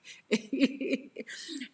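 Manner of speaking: high, loud giggle; tittering laugh that trails on; amused laugh. Real, not acted. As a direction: giggle on after your own joke. Laughs at her own demons.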